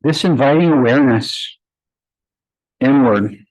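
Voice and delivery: unhurried, even meditation voice with long pauses; guided meditation. Acted. Yoga teacher guiding her class to close their eyes, calm meditation voice. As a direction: ease the class inward, one phrase at a time.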